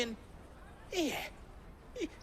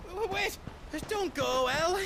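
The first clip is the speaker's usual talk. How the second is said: high, urgent call to stop her; stumble, then call her back before she leaves; 8 semitones higher than usual. Acted. Goetz stopping his friend El from walking off, urgent high call. Calling after departing friend.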